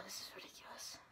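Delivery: breathless whisper. Real, not acted. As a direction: hush it in disbelief. Overwhelmed after win.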